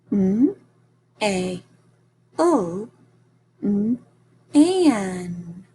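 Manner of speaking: mailman split into separate sounds M, A, L, M, A, N. Shown as a demonstration. Teacher modeling for students, segmenting mailman sound by sound. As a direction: pause between each sound of mailman. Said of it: "Let's try mailman".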